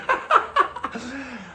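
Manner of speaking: high laugh; laughing at his own joke. Real, not acted. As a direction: crack up at the punchline.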